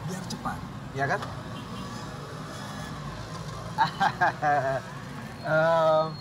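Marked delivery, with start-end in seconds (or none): 1.3-5.4 long, drawn-out chuckle. Acted. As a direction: chuckle on and on at your own pitch. Matchmaker chuckles at his own pitch.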